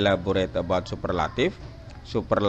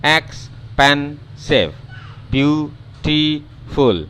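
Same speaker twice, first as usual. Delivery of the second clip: each word stretched very slowly syllable by syllable, higher; slow finger-counting demo; counted out by syllable; 8 semitones higher, 4 dB louder, much slower than usual. Shown as a demonstration. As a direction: tap out every syllable as you say it. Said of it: "dia keluar semua lima jari".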